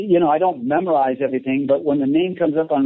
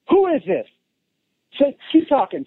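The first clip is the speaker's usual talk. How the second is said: stunned caller; 11 semitones higher than usual. Retold or imitated.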